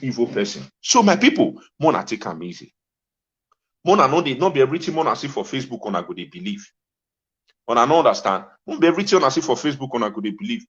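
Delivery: ordinary talk, nothing marked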